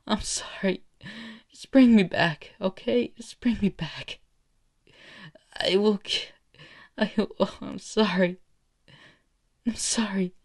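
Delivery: high, broken tearful begging; crying plea. Acted. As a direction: sob out the apology between pauses. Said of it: "screaming and just crying".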